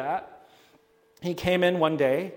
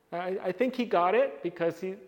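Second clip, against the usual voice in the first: ordinary talk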